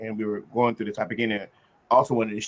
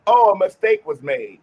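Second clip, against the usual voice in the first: loud, higher, brushing-off mock excuse; vague excuse; 9 semitones higher, 8 dB louder than usual. Shown as a demonstration. Showing the group what not to say: mock brush-off excuse. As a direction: toss it off like a vague corporate excuse. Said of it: "Don't just say".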